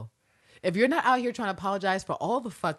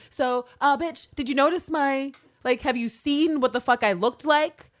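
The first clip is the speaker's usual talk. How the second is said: higher-pitched mock indignation; indignant; 6 semitones higher, 5 dB louder, slower than usual. Voicing the assassin asking if her look was noticed, indignant. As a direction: fish for attention, pitched up and exasperated.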